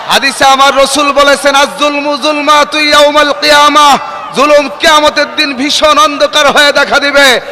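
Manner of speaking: measured waz preaching; a touch of preaching. Real, not acted. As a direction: preach steadily to the gathering. Resumes sermon.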